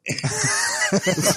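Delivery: high burst of laughter. Real, not acted. Laughing at own kid voice.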